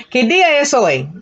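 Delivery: quick conversational pace. Shown as a demonstration. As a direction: speed it up like real talk. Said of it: "with a little bit of speed now".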